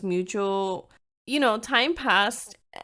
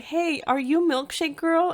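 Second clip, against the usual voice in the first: higher, playful imitation of the guy; eager guy voice; 8 semitones higher than usual. Guy messaging her on MySpace, light mock eager voice. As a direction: pitch it up, eager and cheeky. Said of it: "He's like".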